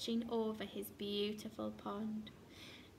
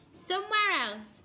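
high, loud snappy dismissal; snapped; 6 semitones higher, 7 dB louder than usual. A narrator's reading. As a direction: snap back bossily. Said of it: "snapped Duck".

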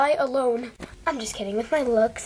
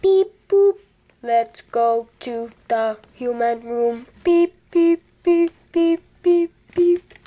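high, loud robotic beep boop; robot alien beeps; 5 semitones higher, 6 dB louder, slower than usual. Pretend. Doing her robot alien dance, high loud robot beeping. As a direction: chant beeps like a robot on the march. Robot heading to mom's room.

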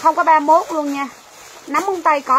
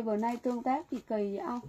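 muttered to herself; 2 semitones lower, 12 dB quieter, faster than usual. Real, not acted. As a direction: mutter it like a private complaint. Seller grumbles at low engagement.

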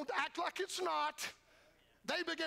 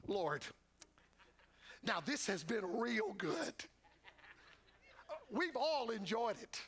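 flattering spokesman; 5 semitones lower than usual. Retold or imitated. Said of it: "the spokesman walking over to Jesus".

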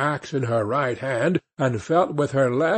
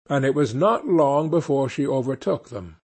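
usual speaking voice

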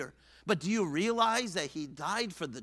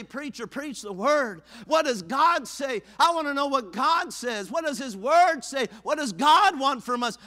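slow, louder, higher plea to the preacher; a churchgoer pleading with the preacher, slow and loud; eager churchgoer; 6 semitones higher, 7 dB louder than usual. Retold or imitated. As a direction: beg to hear the word.

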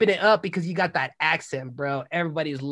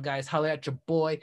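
normal talking voice

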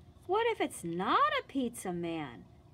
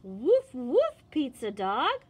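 louder woof like a dog; barking dog; 6 dB louder than usual. Acted. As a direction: bark it out for the children. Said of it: "what does a dog say?".

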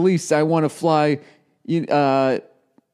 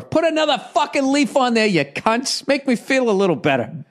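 mock outrage; 10 semitones higher than usual. Mock complaint at airline's logo.

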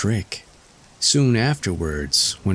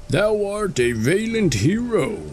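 much higher praising robber voice; admiring robbers; 12 semitones higher than usual. A narrator's reading. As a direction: hail the little hero admiringly. Robbers praise Tom Thumb.

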